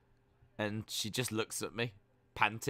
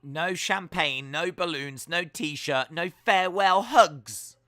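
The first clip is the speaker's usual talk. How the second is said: mock indignant list; 5 semitones higher, 8 dB louder, slower than usual. Wrestler's mock grievance speech.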